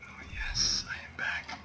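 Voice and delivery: soft whispered relief; whispered yes. Real, not acted. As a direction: whisper it like a quiet victory. Quiet relief on returning to base.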